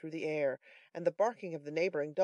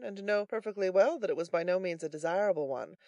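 usual speaking voice

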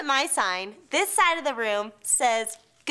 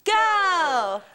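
cheer call; 5 semitones higher, 3 dB louder than usual. Real, not acted. Leading a crowd cheer.

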